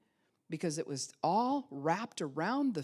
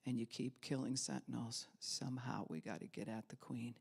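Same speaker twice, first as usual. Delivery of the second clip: usual speaking voice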